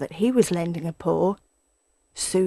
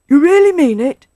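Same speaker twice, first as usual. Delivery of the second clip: louder, high, drawn-up astonishment; amazed, hopeful badger cry; 8 semitones higher, 11 dB louder than usual. A narrator's reading. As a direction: lean hard on "really" in disbelief. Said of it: "cried Badger".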